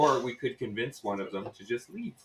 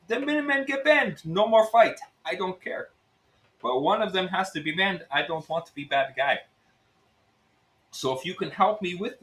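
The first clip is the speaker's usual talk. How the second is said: higher-pitched Russian innkeeper's pitch; Russian accent; 6 semitones higher than usual. Acted. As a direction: sell the scheme in a lively Russian accent. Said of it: "it's Russian".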